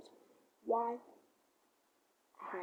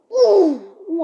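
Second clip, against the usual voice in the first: loud, high hooting taunt; taunting yell; 7 semitones higher, 20 dB louder than usual. Retold or imitated. A boy taunting the man with loud hoots. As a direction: hoot it out to provoke him.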